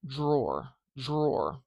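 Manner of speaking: drawer with the dr turning toward a j sound, like jrawer; palatalized dr. Shown as a demonstration. Teacher showing how d and r collide. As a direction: let the d slide into a j. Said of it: "palatalized sound, and it might sound more like".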